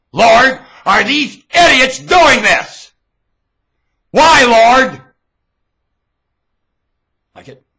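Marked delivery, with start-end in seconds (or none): 0.1-5.0 high, loud cry to God; drawn-out, high yell; shouted appeal. Real, not acted. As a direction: demand an answer from heaven at full voice. Shouts at the Lord.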